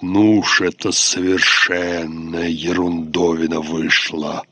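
low, slow disgruntled grumble; grumbling low voice. Acted. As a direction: grumble like a put-out old officer. Dead officer's imagined grumble.

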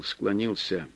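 ordinary delivery

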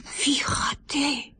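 breathy hushed aside to a friend; hushed whisper. Acted. Child whispers to his friend about the strange footprints, hushed. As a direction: lean in and whisper it.

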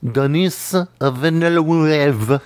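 "Denis Villeneuve" said slowly with a French accent. Shown as a demonstration. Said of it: "pronounce the name ... in French. Just do that".

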